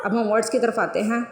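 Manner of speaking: usual speaking voice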